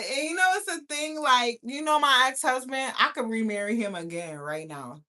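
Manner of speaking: ordinary talk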